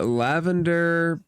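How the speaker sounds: ordinary talk, nothing marked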